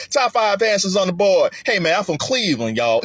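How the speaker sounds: half-sung Southern game-show host; Southern Steve Harvey accent. Retold or imitated.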